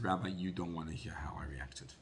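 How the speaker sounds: hushed, breathy confession; whisper. Retold or imitated. Father confiding to the rabbi in a whisper. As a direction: whisper it as if ashamed to say it.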